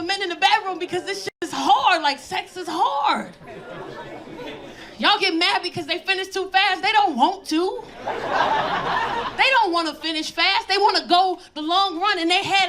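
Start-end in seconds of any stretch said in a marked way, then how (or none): 2.0-3.3 slow emphasis. Acted. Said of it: "slowed for emphasis". 8.0-10.7 drawn-out punchline. Acted. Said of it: "slowed punchline".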